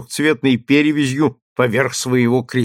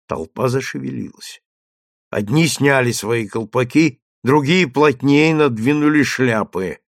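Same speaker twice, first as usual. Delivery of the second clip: plain reading voice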